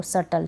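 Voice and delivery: सटल going straight from vowel to T; repeated model word सटल, B left out; silent B. Shown as a demonstration. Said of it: "सबटल नहीं पढ़ेंगे इसको".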